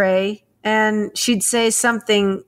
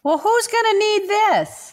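put-on much higher, puzzled old mother; mom's voice; 11 semitones higher than usual. Imitating mom who gave away the cutlery, high and puzzled. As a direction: play the befuddled mom innocently asking.

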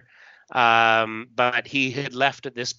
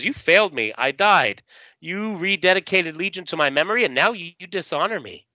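higher, reproachful ghostly accuser; Larissa; 7 semitones higher, faster than usual. Acted. Dead Larissa confronts the man who failed her, acted reproach. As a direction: accuse him of betrayal, melodramatically. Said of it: "She says".

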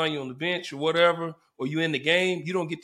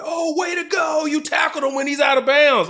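high, loud mocking fan shout; sarcastic heckler; 10 semitones higher, 5 dB louder than usual. Angry fan heckling the linebacker, sarcastic loud shout. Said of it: "one of the fans was like".

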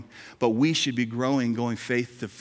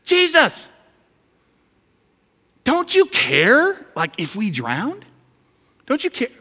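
much higher and louder, slow desperate whine; mock panicked plea; 12 semitones higher, 8 dB louder, slower than usual. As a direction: plead like someone about to drown, hammed-up. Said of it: "Listen to what they say to Jesus".

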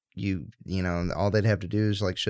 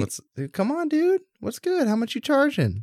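much higher playful haggling voice; high joking voice. Pretend. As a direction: tease in a squeaky high pitch. Playful stud fee bargaining.

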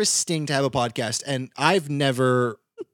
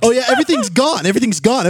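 mock despair; 7 semitones higher, 7 dB louder than usual. Mock heartbreak quote.

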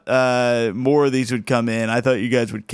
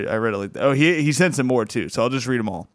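ordinary talk

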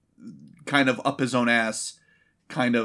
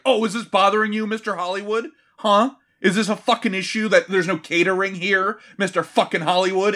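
louder, higher sarcastic needling; mocking taunt; 4 semitones higher, 4 dB louder than usual. Everyone taunting Mister Hollywood about no catering, mocking. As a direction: jeer at the pampered star.

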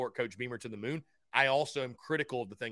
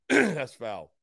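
punchier teasing repeat; louder playful scold; 6 dB louder than usual. Real, not acted. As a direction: hit it louder the second time.

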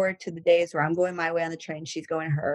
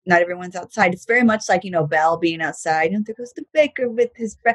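ordinary talk

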